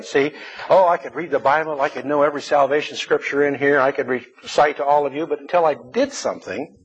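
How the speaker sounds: usual speaking voice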